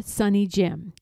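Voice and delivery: plain reading voice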